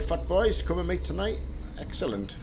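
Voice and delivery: ordinary delivery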